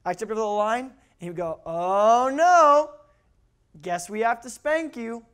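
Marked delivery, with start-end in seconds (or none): 1.7-5.2 long, high, loud "Oh no", then slow regret; mock commiseration. Dad sympathizes with child before spanking, mock dismay. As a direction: lament it along with the kid, hammed-up. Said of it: "Here's what it looked like".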